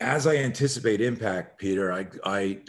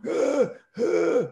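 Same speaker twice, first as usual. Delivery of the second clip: much higher, strained fake moans; faked pain cries; 13 semitones higher, 3 dB louder than usual. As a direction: keep selling the hurt on all fours. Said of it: "on the ground on all fours going".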